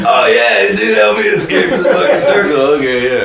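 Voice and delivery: loud, high-pitched sudden remembering; mock realization. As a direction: blurt it out in jest. Mocks player's sudden recollection.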